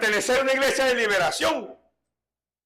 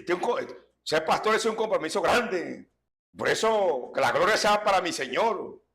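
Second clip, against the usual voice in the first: ordinary delivery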